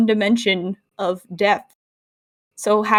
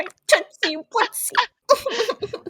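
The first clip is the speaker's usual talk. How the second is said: mock baby talk; 10 semitones higher, 5 dB quieter, much slower than usual. Mocking Koopa's pet name.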